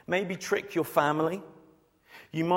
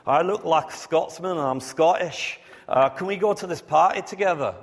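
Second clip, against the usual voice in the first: hammed-up mock Scottish brogue, louder; fake Scottish accent; 2 semitones higher, 6 dB louder than usual. Preacher to bouncers, in a hammed-up fake Scottish accent. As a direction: bluff being a Scotsman at the party door. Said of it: "I go".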